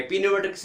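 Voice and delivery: पिन्यूमेटिक्स starting with "pi"; sounded P. Shown as a demonstration. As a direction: wrongly pronounce the p in पिन्यूमेटिक्स. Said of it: "नहीं करेंगे".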